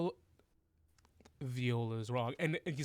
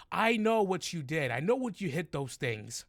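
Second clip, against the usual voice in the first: raised, pressing goblin interrogation; bad-guard accusation; 5 semitones higher, 4 dB louder than usual. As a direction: come at the prisoner high and loud.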